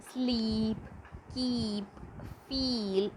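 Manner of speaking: "sleep, keep, feel" with held ee vowels; each word said singly, long "ee". Shown as a demonstration. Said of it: "sounds like long E sounds".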